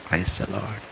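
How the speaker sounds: nearly whispered praise the Lord; hushed greeting. Retold or imitated. Pastor repeats the prescribed greeting, nearly whispered. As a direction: breathe it out, very soft. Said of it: "దైవజనుడు ఇచ్చిన క్రమం".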